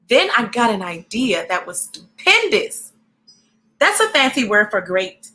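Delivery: lively storybook read-aloud; reading to children. A narrator's reading. Reading a picture book aloud to young viewers, lively.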